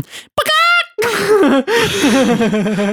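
squeaky burst of laughter; high-pitched laugh. Real, not acted. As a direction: break into a high giggle. Laughing at the chicken sound.

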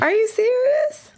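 high-pitched disbelief; can't believe what she just heard; surprised. Real, not acted. Reacting to a town of three.

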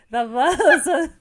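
accent reading collapsing into laughter; high, loud, laughing read; cracking up mid-reading. Real, not acted. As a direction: lose it laughing while reading aloud.

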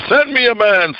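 high blustering challenge; boasting Goliath. Retold or imitated. Preacher voicing Goliath's challenge, high boastful call. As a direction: bellow it like a bragging giant. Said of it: "blustering and boasting and said".

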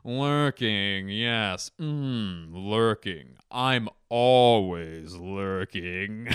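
savoring each word in a stretched-out celebrity impression; musing, elongated, oddball delivery; Jeff Goldblum. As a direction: roll the word around, then draw it out very slowly. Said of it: "Jeff Goldblum just goes".

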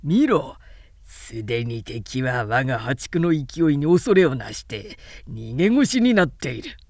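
a low, proud gloat; boastful Wen Chou. A narrator's reading. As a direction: scorn the fleeing enemy.

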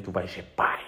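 unvoiced menacing whisper. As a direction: whisper it like a sly threat. Whispered threat to partner.

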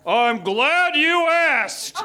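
very slow, drawn-out giant voice; grand, booming put-on voice; booming villain. A narrator's reading. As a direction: stretch each word like a looming giant. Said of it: "boomed a voice".

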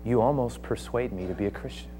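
soft, low, moved; hushed admission. Acted. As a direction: let the king almost concede quietly. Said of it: "And he says".

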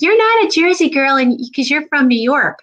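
high-pitched mock-objecting husband; imitated husband. Wife quoting husband disputing her Jersey roots, mock objection. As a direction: object like a contradicting spouse, high then settling. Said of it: "my husband's like".

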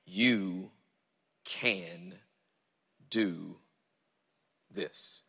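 slow and low, a pause after each word. Shown as a demonstration. Preacher giving the congregation his title, word by word. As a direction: let each word land separately, softening.

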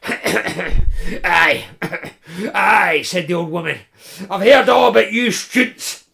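high, loud grumbling crone; old woman voice. Acted.

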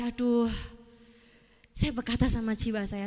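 usual speaking voice

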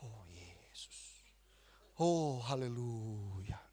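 very slow, hushed devotional voice; imitated eyes-closed worship, drawn out; reenacted prayer. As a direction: stretch each word out in low, soft prayer. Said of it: "saya tutup mata".

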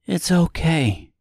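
put-on gentle little girl voice; soft, lightly voiced child; softly. A narrator's reading. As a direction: soothe her quietly. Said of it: "said the girl softly".

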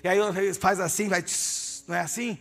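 preaching that swells from steady to loud and high; building sermon. Real, not acted. Preacher urging the congregation, building to a loud peak.

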